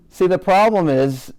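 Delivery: ordinary delivery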